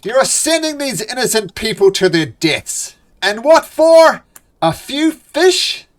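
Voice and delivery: high, loud, slowing outrage; outraged accusation. Acted. Half-elf newcomer furiously confronts the dwarf. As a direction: demand an answer in disbelief.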